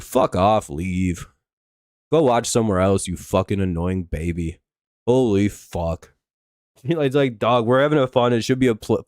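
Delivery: ordinary delivery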